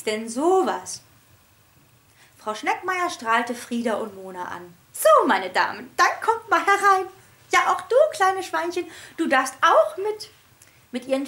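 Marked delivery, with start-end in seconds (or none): 4.9-10.3 high, loud welcoming trill; high chirpy teacher inviting them in. A narrator's reading. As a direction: usher them in gaily.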